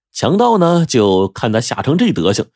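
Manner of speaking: plain reading voice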